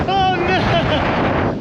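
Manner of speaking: high, laughing into the gusts; wind in the face. Real, not acted. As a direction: blown about, shouting and laughing. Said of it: "I just got blown back".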